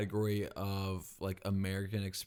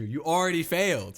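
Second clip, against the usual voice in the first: loud, high triumphant taunt; gleeful gotcha; 7 semitones higher, 8 dB louder than usual. Real, not acted. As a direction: crow over the slip. Gleeful gotcha after curse.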